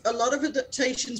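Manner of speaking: normal talking voice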